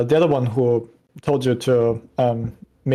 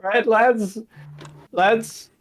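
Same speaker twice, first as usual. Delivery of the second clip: high, loud, laughing at his own joke; self-amused soldier; 8 semitones higher, 4 dB louder, much slower than usual. Acted. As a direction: crow for approval from the lads, pitched way up. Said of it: "laughs about his own joke".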